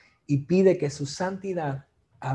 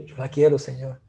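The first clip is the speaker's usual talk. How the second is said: usual speaking voice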